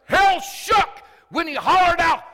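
loud, high, impassioned sermon shout; fired-up preacher at full volume; full-force preaching. Real, not acted. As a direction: drive the point home to the congregation.

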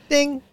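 short high doorbell ding; doorbell ding imitation. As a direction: mimic a quick press of a doorbell.

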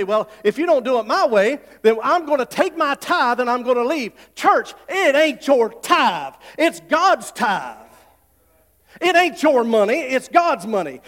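slow, loud, emphatic preaching; preaching at full force. Acted. Preacher scolding the church about tithes, slow loud preaching. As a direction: lean into each word like a preacher.

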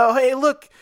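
high, bright tourist voice; cheerful balloon. Pretend. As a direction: gawk like an excited sightseer. Balloon sightseeing over Canada.

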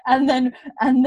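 high, slow words through laughter; laughing. Real, not acted. Telling the friend about Bree popping up, laughing. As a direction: giggle it out. Laughing at Bree's sudden appearance.

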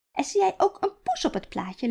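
put-on high, sing-song question to a toddler; baby talk. Grandmother pointing out the cat to Iris, high baby talk. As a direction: coax the little one to look.

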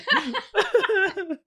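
high burst of laughter; loud, high laugh; big laugh. Real, not acted. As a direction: crack up at the joke. Joke about deductible.

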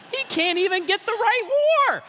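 high, loud mock indignation; hammed-up high-pitched outrage; outraged mock shout. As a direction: shout it like an appalled churchgoer. Voicing outraged congregation historians.

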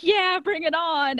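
egging the blades on, slowly; eager robot. Retold or imitated. Robot to the booth, slow and eager. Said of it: "And the robot's like".

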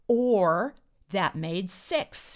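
mild argumentative shout. A narrator's reading. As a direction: call it out slowly in protest. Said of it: "shouted Jay".

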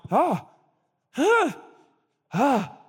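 high, spaced yelps of mock agony; pained gasps. Preacher reliving his aching toe, high pained gasps. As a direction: gasp at each stab of pain. Mimes repeated toe pain.

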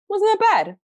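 high, put-on voice of a grudging dad; imitating dad. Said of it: "he was".

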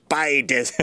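lightly voiced pirate; a pirate puppet's pitch. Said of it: "little hand puppet".

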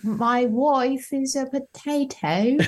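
slow, badly mangled Irish accent. Shown as a demonstration. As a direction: drag it out in a terrible fake Irish brogue. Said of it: "Kyle's going to have an Irish accent".

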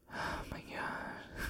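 whispered, drawn-out disbelief; whispered aside. Real, not acted. Host despairing at polo-shirt guy, whispered aside. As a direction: whisper it slowly under the breath. Exasperated aside during the rant.

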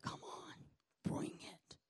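hushed, eager whisper; mock whispered dare. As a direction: whisper it like a guy daring God to hit him. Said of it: "that guy who's like".

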